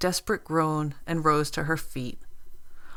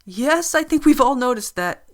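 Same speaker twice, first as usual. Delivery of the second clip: suppressed nervous laugh; 8 semitones higher, 7 dB louder than usual. Acted. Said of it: "choking back a nervous laugh".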